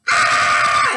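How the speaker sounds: sudden high unvoiced scream; retold scream. Patient at the nurse, shrieking before she touched it. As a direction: shriek like the pain already hit. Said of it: "I started screaming".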